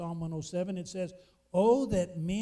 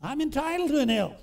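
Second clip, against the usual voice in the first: mock demanding, higher and louder; hammed-up entitled hunter; 6 semitones higher, 7 dB louder than usual. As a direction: play the hunter insisting he's owed an elk. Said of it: "and he said, God".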